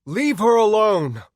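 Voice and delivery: loud, high, drawn shout; furious high yell; scream. Acted. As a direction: scream at him to stop. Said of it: "I screamed".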